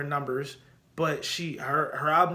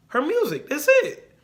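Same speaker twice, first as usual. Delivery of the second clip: emphatic; 10 semitones higher, 6 dB louder than usual. Real, not acted. Emphatic defence of [name].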